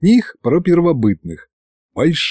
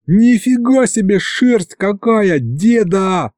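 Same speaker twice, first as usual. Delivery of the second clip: put-on high slow astonished boy; boy marvels at the mammoth's fur; amazed yell; 8 semitones higher, slower than usual. A narrator's reading. High slow amazed exclaiming. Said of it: "орал я".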